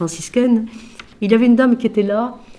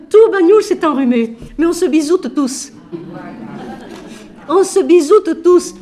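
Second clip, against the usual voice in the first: excited exclaim; 7 semitones higher, 3 dB louder than usual. Real, not acted. Exclaiming everyone kisses.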